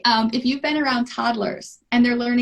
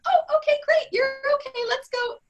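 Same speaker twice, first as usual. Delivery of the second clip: high, light singsong reassurance; breezy reassuring parent; 12 semitones higher than usual. Acted. Parent comes over to fallen toddler, breezy and reassuring. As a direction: brush the fall off in a high voice.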